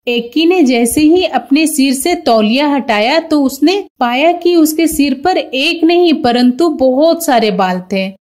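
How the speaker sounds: ordinary delivery, nothing marked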